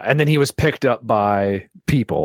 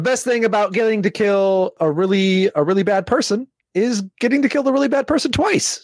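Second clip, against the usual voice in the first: gleeful; 12 semitones higher than usual. Real, not acted. Gleeful about killing him again.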